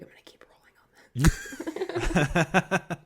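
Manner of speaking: slow, laughing whisper; sly whisper. Retold or imitated. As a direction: whisper it like a sneaky secret. DP quietly decides to keep filming.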